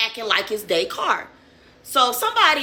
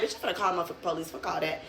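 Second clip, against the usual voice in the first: very fast, soft aside; quick dismissal; 4 semitones lower, 10 dB quieter, much faster than usual. Retold or imitated. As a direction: rattle it off low and fast, brushing it off. Re-enacting own dismissive reaction.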